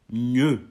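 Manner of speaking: "Gne" with the gn of gagner; gn sound. Shown as a demonstration. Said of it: "comme dans gagner". (« Gne » comme dans gagner, bien détaché)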